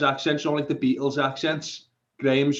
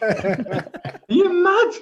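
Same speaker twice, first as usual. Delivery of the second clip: drawn-out, much higher, laughing "are you mad"; outraged disbelief; 5 dB louder, much slower than usual. Real, not acted. Mock outrage at posh claim.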